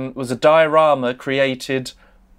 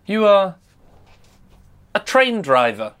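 dramatic storytelling. Acted. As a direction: paint the scene like an old storyteller. Dramatic storyteller scene setting.

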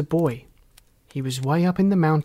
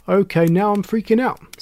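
higher, louder alarmed voice; 5 semitones higher, 6 dB louder than usual. A narrator's reading. Christopher alarmed.

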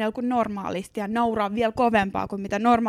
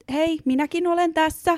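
mock indignant call; 6 semitones higher, 3 dB louder than usual. Excluded from men's conversation.

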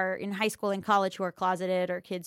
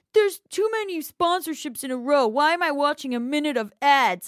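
mock complaining fan voice, higher and louder; whiny viewer; 6 semitones higher, 6 dB louder than usual. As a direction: imitate a whining viewer griping about ads. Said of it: "they would just be like".

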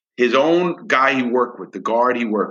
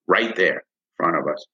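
ordinary delivery

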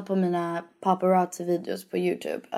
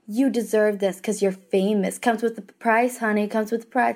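taunting paparazzo. Retold or imitated. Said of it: "Och de bara".

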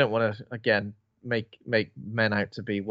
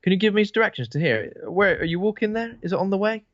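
lightly voiced, higher-pitched asking for directions; imitated woman driver; 8 semitones higher than usual. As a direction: put on a higher, questioning lure. Voicing woman luring men from van.

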